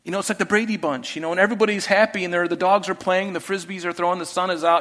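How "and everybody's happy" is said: ordinary talk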